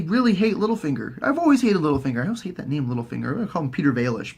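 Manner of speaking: usual speaking voice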